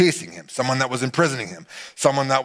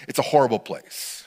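ordinary talk, nothing marked

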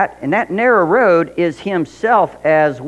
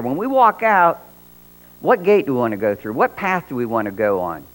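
ordinary delivery

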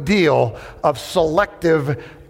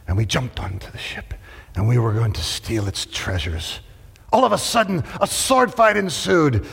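pirate voice; 5 semitones lower than usual. Acted. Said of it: "He said".

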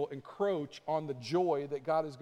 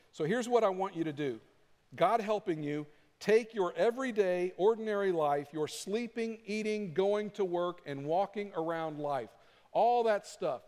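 higher, forceful preacher's reading; emphatic Scripture reading; 3 semitones higher than usual. Acted. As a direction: read the passage out with conviction, pitched up. Reads a Scripture passage with force.

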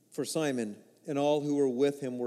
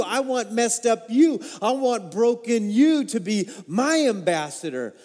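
passionate declaration; 4 semitones higher, 6 dB louder than usual. Acted. Jesus's passionate call.

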